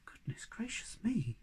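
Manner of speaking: soft whispered wonder; awed whisper. Acted. Moomin Troll recovering on the grass, whispering. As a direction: breathe it again to himself. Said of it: "he repeated".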